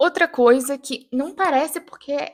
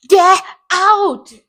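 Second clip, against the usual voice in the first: explosive mock-furious yell; high, very loud acted order to leave; imaginary English fight; 7 semitones higher, 11 dB louder than usual. Fighting with herself at the mirror, dramatic furious yell. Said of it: "brigando comigo mesma".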